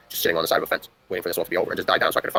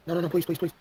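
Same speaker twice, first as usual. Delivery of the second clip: higher, frantic begging; mock pleading; 9 semitones higher, 5 dB quieter than usual. Teen begging the officer not to shoot, high frantic pleading. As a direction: beg like there's a gun on you.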